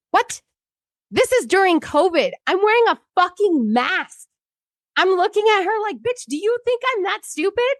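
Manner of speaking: high-pitched, indignant disbelief; incredulous outrage. Retold or imitated. Reliving the worker's accusation during covid, incredulous outrage. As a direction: voice shoots up in exasperated disbelief.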